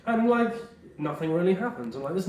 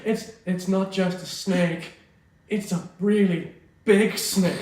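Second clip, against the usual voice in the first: slow, high hammy line read; bad voice acting; 4 semitones higher, slower than usual. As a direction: ham it up like a bad dub. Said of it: "voice acting was hilariously bad".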